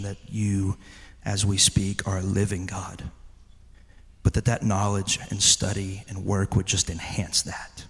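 low, measured prayer with pauses; closing prayer. Real, not acted. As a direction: pray quietly over the congregation.

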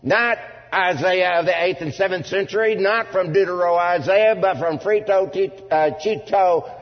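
loud, high-pitched pulpit proclamation; full-force preaching. Real, not acted. Preacher warning the congregation people will not stand, full-force preaching. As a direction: drive each phrase home like a fired-up preacher.

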